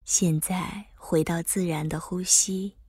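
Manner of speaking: calm guide easing the body; guided meditation. Acted. Leads breath focus meditation.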